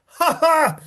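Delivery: gleeful cackle. Real, not acted. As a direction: gloat over the villain's death. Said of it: "I got so angry".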